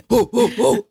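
hyped whoa whoa whoa crowd call; imitating Arsenio. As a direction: hype it like Arsenio's audience. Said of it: "do the Arsenio".

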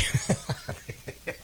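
very soft, breathy snicker; real laugh. Real, not acted. Host amused nobody told the university, breathy laugh. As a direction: laugh almost soundlessly to yourself.

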